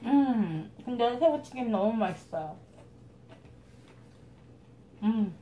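chewing while talking; speaking through a mouthful of food; mouth full. Real, not acted. Seen 1: Cheeks bulging, chewing throughout; bite taken at -3 s.

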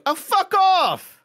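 squeaky high, slow grumpy brush-off; Gary impression. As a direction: screech it way up high and drag it. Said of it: "Gary goes".

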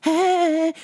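mocking flirty greeting. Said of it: "she come and talk to me like".

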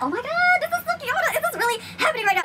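very high, giddy fan squeal in words; starstruck. Retold or imitated. Fan seeing Suki approach, starstruck high-pitched disbelief. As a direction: replay a disbelieving fangirl freak-out, pitched sky-high.

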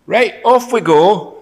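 loud, high, drawn-out marching order; rousing command. Acted. Joshua sending his generals off, loud rallying command. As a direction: call the troops to set off.

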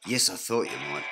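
ordinary delivery

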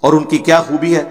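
normal talking voice

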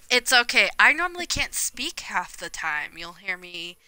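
ordinary delivery

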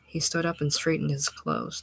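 usual speaking voice

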